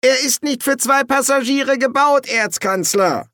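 high, anxious character voice; plaintive high-pitched complaint; high protesting voice. A narrator's reading. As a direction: protest it up high, dragging the last word. Rider protests to angry Erzkanzler.

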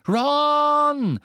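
long high loud call. Acted. Harry crying out to Ron, loud high call. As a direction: call his name sharply. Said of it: "rief er".

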